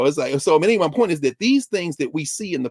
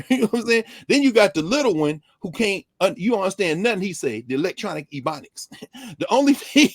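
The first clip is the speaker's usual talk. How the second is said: usual speaking voice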